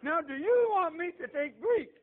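high, loud preacher's outburst; retold plea cried out to heaven; incredulous protest. Struggling student protests to the Lord, loudly incredulous.

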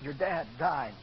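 low, softening, solemn voice; grave, gentle news-breaking. Retold or imitated. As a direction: break it softly, with long pauses. Preacher gently breaks death news.

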